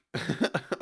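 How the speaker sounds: short, high chuckle breaking off the thought; brief laugh. Real, not acted. Breaks off his question laughing.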